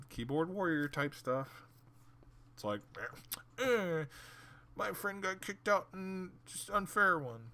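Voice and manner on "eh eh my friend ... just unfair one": high, slow nasal-free whine mocking a child; mock the kid's complaint; mock whiny kid.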